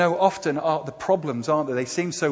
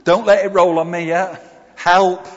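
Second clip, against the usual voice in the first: loud, raised-pitch cry for help; half-play a boy calling out in alarm; mock plea for help; 4 semitones higher, 7 dB louder than usual. Boy under a rock praying for help, loud mock plea.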